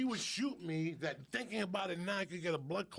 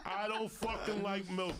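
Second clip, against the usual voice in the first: higher-pitched, insistent repeat; emphatic declaration; 6 semitones higher than usual. Real, not acted. Comic repeats his confession to hosts, emphatic and higher.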